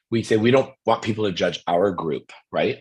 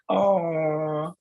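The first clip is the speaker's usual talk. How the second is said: high, drawn-out aww; cooing; 9 semitones higher than usual. Retold or imitated. Onlookers watching the airport reunion, cooing. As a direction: coo like a charmed crowd. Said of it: "everyone is like".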